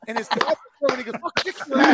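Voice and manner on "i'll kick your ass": higher, louder threat; threatening shout. Retold or imitated. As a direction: bark a furious threat. Dad threatens back.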